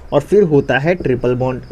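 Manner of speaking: usual speaking voice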